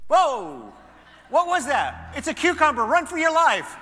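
loud, high panicked cat voice. Acted. Preacher voicing a terrified cat in a video. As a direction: shriek like a startled cat.

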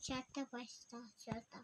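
very soft, low mumble; barely audible. Real, not acted. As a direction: murmur the count almost inaudibly. Child trails off very quietly.

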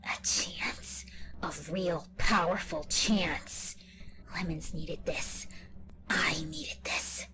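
whispered megalomaniac rant; villain speech. Acted. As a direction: gloat and threaten in a hush. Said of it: "my final villain speech".

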